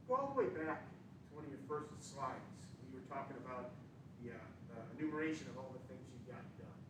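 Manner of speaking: muffled, far from the microphone. Real, not acted. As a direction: speak away from the mic, muffled. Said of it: "I'm muffled and I have my".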